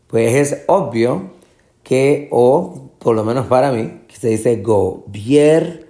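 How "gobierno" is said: gobierno split as go, BIER, no; syllable by syllable. Shown as a demonstration. Teacher points out tonic syllable, gobierno broken up with BIER stressed. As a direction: hold BIER long and rising in gobierno. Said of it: "Esta es la sílaba tónica".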